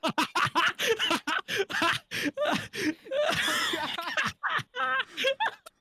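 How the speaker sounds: long, high-pitched laughing fit; helpless laughter. Real, not acted. Host cracks up when guest's connection glitches, helpless laughter. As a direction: burst out in high, uncontrollable laughter.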